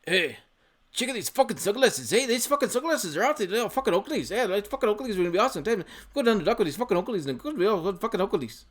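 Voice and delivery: high, rowdy Irish brogue, slurred with drink; drunk Irish accent. Retold or imitated. Vlogger imitating drunk Irishmen in the sunglass shop, high rowdy Irish accent. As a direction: ham up a drunk Irish dockworker raving over sunglasses. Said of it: "these guys are like".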